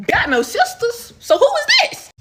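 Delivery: high, loud laughing disbelief; laughing shock. Real, not acted. Shocked at sister message.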